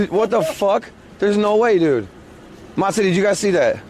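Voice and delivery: ordinary talk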